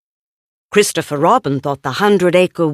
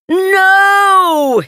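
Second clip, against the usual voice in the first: a high, loud cry of dismay; exclaimed; 13 semitones higher, 5 dB louder than usual. Acted. Christopher Robin crying out as his papers scatter, high and loud. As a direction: cry out as papers fly away. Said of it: "he exclaimed".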